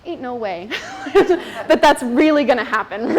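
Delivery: amused mock scepticism; laughing disbelief. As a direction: scoff at it with a laugh. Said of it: "I was like".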